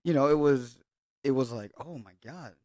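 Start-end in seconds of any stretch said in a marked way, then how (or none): none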